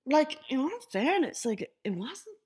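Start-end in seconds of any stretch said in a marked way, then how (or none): 1.4-2.4 sheepish whispered admission. Real, not acted. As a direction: drop to a guilty whisper. Admitting it wasn't that bad.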